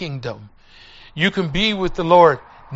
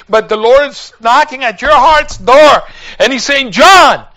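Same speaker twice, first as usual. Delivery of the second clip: raised, high-pitched urgent appeal; urgent preaching plea; 7 semitones higher, 10 dB louder than usual. Retold or imitated. Preacher urging a dying neighbor at his bedside, urgent loud plea. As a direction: plead at full preaching volume.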